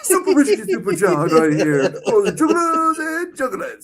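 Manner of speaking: high, loud mock announcer; hype announcer. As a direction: ham it up like a showman. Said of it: "we gotta do a superhuman".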